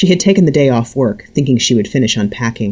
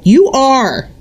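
high, loud furious cry; shouted; 13 semitones higher, 5 dB louder than usual. A narrator's reading. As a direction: shout her down. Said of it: "her mother shouted".

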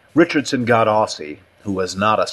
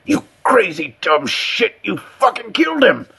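horrified accusation; 11 semitones higher than usual. Acted. Cut and Shoot thinks Richardson killed him, horrified. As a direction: accuse him in rising horror, pitch climbing.

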